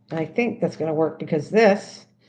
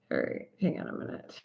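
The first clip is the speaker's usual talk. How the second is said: much lower, soft whispered aside; 10 semitones lower, 12 dB quieter than usual. Real, not acted. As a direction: drop to a breathy whisper, off to the side. Aside while adding a viewer.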